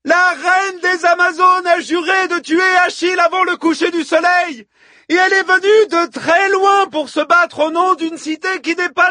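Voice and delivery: very high, loud war speech; bellowing. A narrator's reading. Hippodamie invokes the Amazon queen to rally women, bellowing. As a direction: whip a crowd up to fight, full voice. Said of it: "beugla Hippodamie au sein du vacarme".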